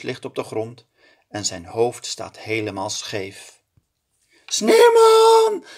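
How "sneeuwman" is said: very high, loud shriek. A narrator's reading. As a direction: princess sees the melted snowman. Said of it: "gilt prinses Titia". (schrille gil, heel luid)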